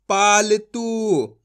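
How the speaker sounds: पालतू said very slowly, तू held long; long drawn ऊ. Shown as a demonstration. Teacher models the long ऊ, drawn out. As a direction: stretch तू in पालतू. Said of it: "खींच के पढ़ा जाता है".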